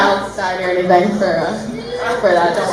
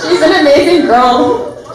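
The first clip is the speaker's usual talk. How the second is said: high, slow, tear-choked praise; tearful voice; 5 semitones higher, slower than usual. Real, not acted. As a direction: push slowly through the tears. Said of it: "my voice sounds awful".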